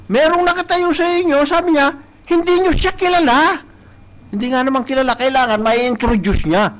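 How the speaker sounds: impassioned pulpit delivery; preaching. Acted. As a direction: preach it out to the congregation. Preaching at full force.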